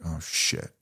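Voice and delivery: low, soft, hushed realization under the breath; hushed oh shit. Retold or imitated. In the silent truck, realizing what he just admitted, hushed. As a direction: let it slip out quietly, stunned.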